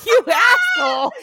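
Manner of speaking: shrieking with laughter, high and loud; real laughing outburst; laughing shout. Real, not acted. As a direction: yell it through the laugh. Laughing insult at friend.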